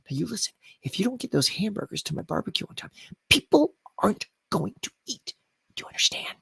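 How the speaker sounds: mock-intense hushed threat; whisper acting. Shown as a demonstration. Streamer parodying a barbecue crisis, intense whisper. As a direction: whisper it with exaggerated gravity. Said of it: "do they start whispering".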